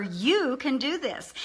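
"you" stretched and emphasized; stress on YOU. Shown as a demonstration. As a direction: lean hard on "you", slowly. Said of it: "you want to emphasize words like".

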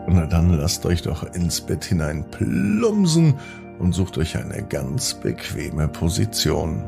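ordinary delivery, nothing marked

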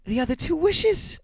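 high, loud and excited; frantic high-pitched insistence; rapid, eager reply. A narrator's reading. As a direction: answer eagerly in a high old woman's voice. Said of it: "she replied rapidly".

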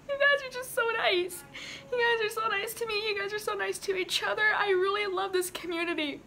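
high, choked-up gratitude; moved and weepy, pitch lifted; tearful, crying. Real, not acted. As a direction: gush through real happy tears. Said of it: "it's making me cry".

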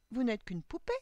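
exclamation. A narrator's reading. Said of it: "s'écria-t-elle".

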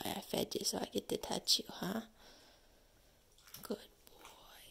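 soft whisper to a dog. Real, not acted. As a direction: hush it to the puppy. Softly to the dog.

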